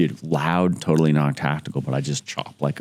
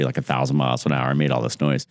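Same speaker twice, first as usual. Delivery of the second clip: normal talking voice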